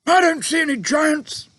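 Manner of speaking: high, loud gruff officer; army chief grumbling to the giant, loud; blustering army chief. A narrator's reading.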